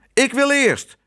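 high, insistent cry; shrieked demand, pitch way up; jealous shout. A narrator's reading. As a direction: shout to push in first. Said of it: "riep hij".

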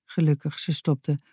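ordinary delivery

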